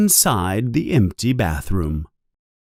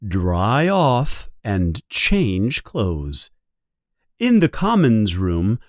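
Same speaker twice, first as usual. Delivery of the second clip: usual speaking voice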